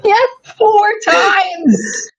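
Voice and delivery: high laughing answer. Real, not acted. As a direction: squeal it out through laughter. Laughing confirmation.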